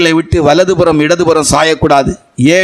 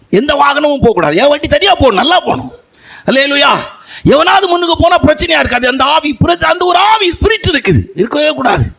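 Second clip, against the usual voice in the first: high, ringing preacher's cry over the congregation; preaching at full force; 8 semitones higher than usual. Acted. Pastor to congregation about his driving, preaching at full force. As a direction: lift it up and proclaim to the church.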